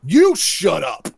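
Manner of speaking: acted-out kid's indignant snap-back; indignant retort. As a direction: hammer it out as an indignant comeback. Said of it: "Bobby gets all indignant and points".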